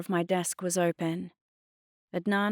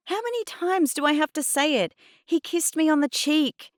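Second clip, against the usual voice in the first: high-pitched, heated exasperation; insist, fed up with repeating it; exasperated protest; 11 semitones higher, 5 dB louder than usual. A narrator's reading. Girl defending a kiss to Mum, exasperated.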